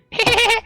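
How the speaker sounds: high-pitched giggle. Acted. NPC giggle behind the bar.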